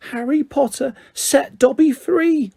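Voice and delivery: very high, slow elf voice; awed elf. A narrator's reading. Elf gazing up at Harry, slow and awed. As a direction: savour each word in wonder.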